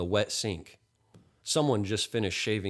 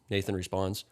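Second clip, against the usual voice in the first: ordinary delivery, nothing marked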